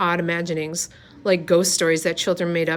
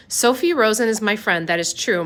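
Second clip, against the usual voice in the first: ordinary delivery, nothing marked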